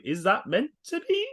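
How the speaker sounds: high, slow, wondering question; puzzled question. Retold or imitated. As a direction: ask it slowly in high puzzlement. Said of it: "I was like".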